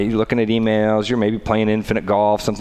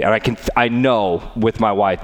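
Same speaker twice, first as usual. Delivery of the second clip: normal talking voice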